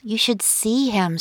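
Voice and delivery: ordinary delivery, nothing marked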